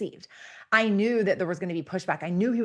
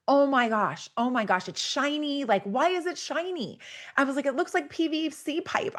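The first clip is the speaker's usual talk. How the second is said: retold panic; 7 semitones higher than usual. Panicking at the shiny roof.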